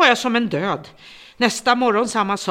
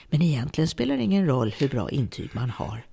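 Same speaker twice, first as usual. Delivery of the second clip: ordinary talk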